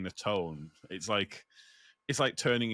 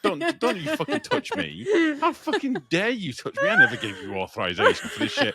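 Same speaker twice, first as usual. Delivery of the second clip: high, outraged squeal of an offended cat; scared and angry; 6 dB louder than usual. Retold or imitated. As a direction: squeal it like a furious, startled cat.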